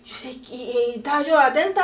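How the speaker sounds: ordinary delivery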